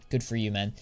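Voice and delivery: ordinary delivery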